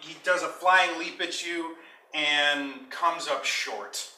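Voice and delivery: ordinary talk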